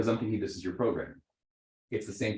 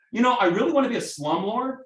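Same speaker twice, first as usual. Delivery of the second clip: mock client voice; 11 semitones higher, 5 dB louder than usual. Client voiced in sales scene.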